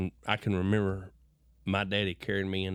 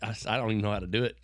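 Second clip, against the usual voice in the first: ordinary talk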